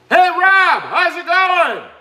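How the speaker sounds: very high, loud hollered greeting; exaggerated high-pitched shout, last words drawn out; all-caps mock yell. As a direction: read it out as if typed in all caps. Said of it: "Because of the caps".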